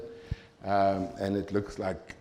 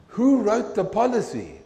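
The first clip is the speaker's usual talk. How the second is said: higher, louder, challenging question; indignant demand; 11 semitones higher, 6 dB louder than usual. Retold or imitated. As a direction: push back hard on him. Confronting the supervisor.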